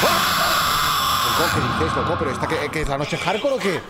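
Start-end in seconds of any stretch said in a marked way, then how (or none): 0.1-1.4 sudden high-pitched scream; real startled high yelp. Real, not acted. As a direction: scream out at a scare. Startled in a horror game.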